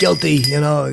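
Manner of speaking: loud, high, self-pleased guilty; smug guilty plea. Retold or imitated. Bell answers the fan, loud and smug. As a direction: own it like a proud celebrity. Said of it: "something like that".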